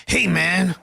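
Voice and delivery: loud gruff greeting as Goggins; hammed-up Goggins "hey man"; Goggins impression. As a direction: bark it like David Goggins. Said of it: "That's my Goggins impression".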